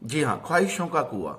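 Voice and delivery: ordinary delivery, nothing marked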